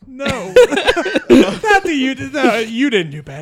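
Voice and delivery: high, loud laughing reply; laughing while talking. Real, not acted. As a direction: talk through laughter.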